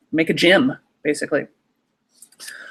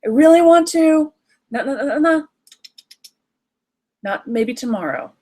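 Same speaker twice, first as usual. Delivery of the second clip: put-on child and parent; 8 semitones higher than usual. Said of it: "If you imagine that conversation".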